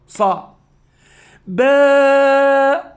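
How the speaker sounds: صا then باء held long and high; sounding out syllables. Shown as a demonstration. Teacher sounding syllables for children, slowly. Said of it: "حرف الصاد هنا صوت".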